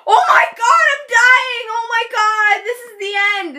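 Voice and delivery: hammed-up dying wail; high, loud mock hysteria easing slightly; freaking out. Hurt girl to friend, melodramatic panic about dying. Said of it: "I was there freaking out".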